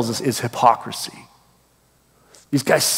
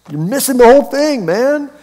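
high-pitched incredulous outburst; mock-exasperated, voice jumping way up; exasperated disbelief; 10 semitones higher, 4 dB louder than usual. Preacher reacting to Pharisees who want a sign, exasperated.

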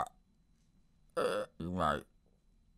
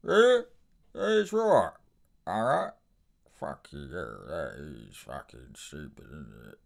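Alfie Solomons impression; 11 semitones lower than usual. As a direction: snap then sink into menace. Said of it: "The body language is very intense".

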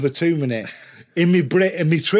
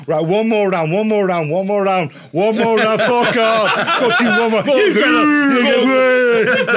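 building to a loud, high, laughing shout; rising from firm insistence to a wrestler's roar; demanding another round; 6 semitones higher, 5 dB louder than usual. Retold or imitated. Telling the organisers he wants another round, building to a laughing roar. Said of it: "I'm going".

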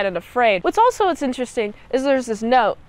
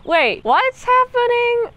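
baffled; 10 semitones higher, slower than usual. Retold or imitated. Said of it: "I'm like".

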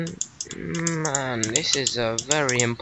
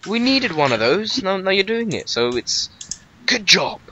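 patronising praise; 7 semitones higher than usual. Pretend.

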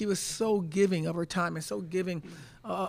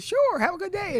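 much higher, louder Southern accent imitation; gracious Southern farewell, imitated; 13 semitones higher, 7 dB louder than usual. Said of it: "And she said".

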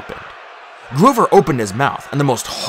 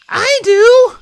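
very high, loud squeal; high girlish outburst; excited declaration; 9 dB louder than usual. A narrator's reading. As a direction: burst out adoringly. Juniper hugs Grover fiercely.

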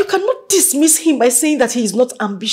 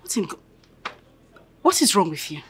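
ordinary talk, nothing marked

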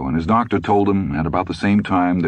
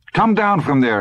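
high-pitched nagging order; insistent wifely command, pitched up; scolding wife; 12 semitones higher than usual. A narrator's reading. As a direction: order him down, exasperated. Wife orders husband off ladder.

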